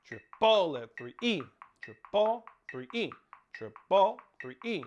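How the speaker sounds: loud triple, lighter three E; accented counting. Shown as a demonstration. Drum teacher counting the accent pattern aloud. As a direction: punch each triple then ease off on three E. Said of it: "It's a reactionary accent to the downbeat".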